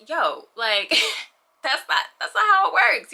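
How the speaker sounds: a sharp, laughing "that's not how it works"; laughing exasperation. Retold or imitated. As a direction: push back, half laughing. Exasperated retort to expectations.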